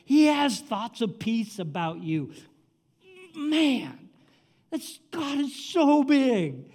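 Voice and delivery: high, loud preacher's fervor; full-force preaching. Real, not acted. Preacher urging the congregation not to worry, impassioned.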